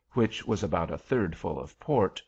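ordinary delivery

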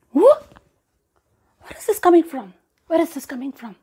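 startled cry, high and loud; startled farmer. A narrator's reading. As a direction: jump at a sudden noise.

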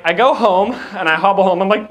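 ordinary talk, nothing marked

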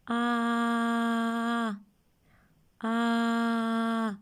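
А held long, mouth wide open. Shown as a demonstration. Teacher modelling the vowel with a mirror. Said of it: "Дивимося в люстерко і вимовляємо".

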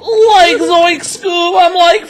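high, hammed-up cartoon freak-out; mock a friend's panic in a high cartoon voice; Scooby-Doo style panic. Mocking the player freaking out, high cartoon panic. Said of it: "That's what you sounded like".

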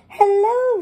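put-on high, bright little-girl hello; lightly voiced Peppa welcoming guests; Peppa greeting.

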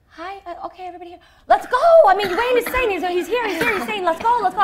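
high, loud, urgent mock dad; imitating intense dad. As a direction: hurry everyone out like a pushy father. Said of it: "He's so, he's so intense".

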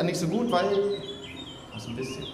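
usual speaking voice

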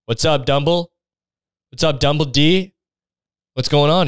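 breezily over-familiar, lightly put on; cheeky student. Student drops in on the headmaster, cheeky and casual. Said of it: "you're just like".

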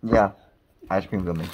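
normal talking voice

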